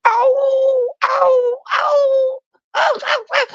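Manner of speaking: very high yelping howl imitating the dog; dog's high howling ow; coyote howl. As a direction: cry out like a coyote in pain, ow ow ow. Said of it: "like a goddamn coyote".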